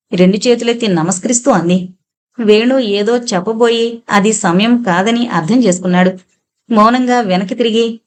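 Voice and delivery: ordinary delivery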